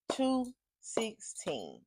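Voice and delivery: slow, drawn-out whisper; stunned whisper. Retold or imitated. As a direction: whisper each word in disbelief. Reveals the late time.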